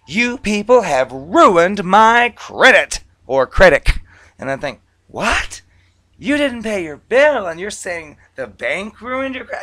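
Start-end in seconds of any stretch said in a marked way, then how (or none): 0.1-3.9 very loud, high, slow mock tirade; outraged caller. Debtor calling the bank over ruined credit, loud outrage. 5.1-9.6 high-pitched, loud mock disbelief; incredulous. Bank rep's inner reply to a blaming debtor, incredulous.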